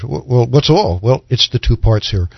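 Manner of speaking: ordinary delivery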